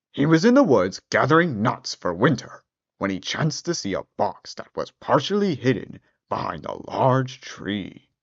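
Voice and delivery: usual speaking voice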